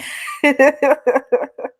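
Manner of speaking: high knowing cackle; burst of laughter. Real, not acted. Laughing at innuendo.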